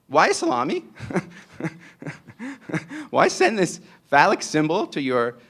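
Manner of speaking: mock incredulous. Real, not acted. Mock-puzzled rhetorical question.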